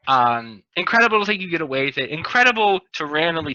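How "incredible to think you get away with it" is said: ordinary talk, nothing marked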